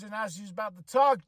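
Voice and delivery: ordinary delivery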